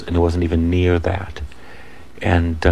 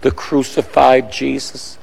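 awed testimony; 6 semitones higher than usual. Retold or imitated. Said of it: "She said".

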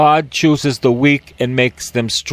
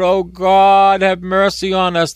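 raised, pleading plea to God; mock pleading cry; 5 semitones higher than usual. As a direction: beg for mercy, a bit higher. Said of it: "they cried out".

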